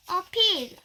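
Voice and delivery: usual speaking voice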